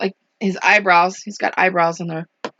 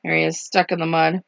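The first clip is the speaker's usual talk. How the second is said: plain reading voice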